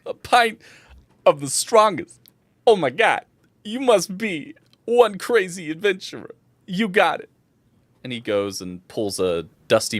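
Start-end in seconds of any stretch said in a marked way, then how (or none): 0.1-7.3 amazed bartender. Acted. Bartender taking an order for the strongest pint, high and amazed. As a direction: play an impressed, high-voiced barkeep.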